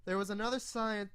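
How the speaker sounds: ordinary delivery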